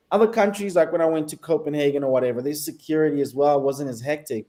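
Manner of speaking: ordinary talk, nothing marked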